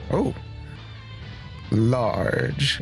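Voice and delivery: normal talking voice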